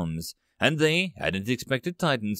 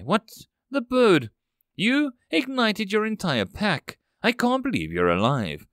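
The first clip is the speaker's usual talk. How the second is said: surprised exclamation; 7 semitones higher than usual. Acted. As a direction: blurt it out, amazed he lives. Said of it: "she exclaimed in surprise".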